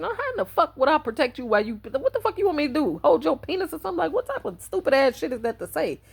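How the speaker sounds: high-pitched, incredulous questioning; appalled confusion. Retold or imitated. Reacting to a question about protecting him, appalled and confused. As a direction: throw the questions out in baffled disbelief. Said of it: "so confused and appalled by the question".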